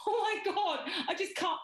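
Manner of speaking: high, laughing imitation of ridicule; mocking laughter. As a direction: act out cracking up at someone. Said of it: "I would never go".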